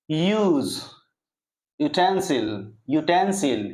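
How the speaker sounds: यूज and यूटेंसिल with a lengthened "yoo"; long "yoo". Shown as a demonstration. Teacher giving examples of the long sound, held "yoo". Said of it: "लंबे समय तक यू बोलना है".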